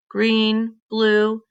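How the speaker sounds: usual speaking voice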